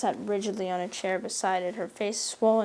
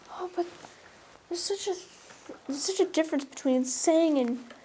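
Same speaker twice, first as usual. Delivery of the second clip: higher, plaintive wail; 5 semitones higher than usual. A narrator's reading. Anne wailing her hurt to Marilla. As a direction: let it break into a wail. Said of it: "wailed Anne".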